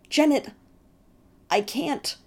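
high, loud panicked cry; frightened cry. Acted. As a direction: cry out in terror. Terrified brother cries out.

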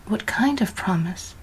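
timid whisper, breath only; whispered question. A narrator's reading. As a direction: ask it under your breath. Girl asks Death.

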